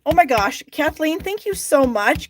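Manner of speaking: light up and thank her warmly; excited thanks. Real, not acted. Viewer sends a tip live.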